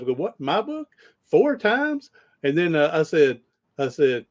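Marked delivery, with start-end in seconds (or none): 0.1-2.1 mock astonishment, pitch shooting up high on each question; acting surprised again. High, rising, over-the-top disbelief. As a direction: coach replaying his fake shock for the listener, hammed-up surprise. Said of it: "I'm acting surprised".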